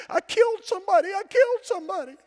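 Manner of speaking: high frantic screaming; retold panicked scream. Daughter on the phone after a wreck, screaming in panic. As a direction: shriek it like a terrified teen. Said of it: "she's screaming".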